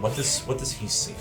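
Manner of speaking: ordinary talk, nothing marked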